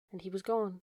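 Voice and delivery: ordinary delivery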